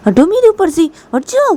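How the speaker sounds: high, loud furious shout; angry scolding. Acted. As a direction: shriek at her to get off. Ruhi डांटती है, चिल्लाकर. (ऊँची तीखी आवाज़ में गुस्से से डांटना)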